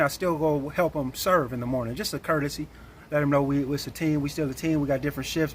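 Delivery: ordinary delivery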